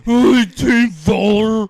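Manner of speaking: slow, very loud, high mock bellow; drawn-out meathead roar; ape-like bro. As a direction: holler it like a knuckle-dragging ape. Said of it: "like a bunch of fucking apes".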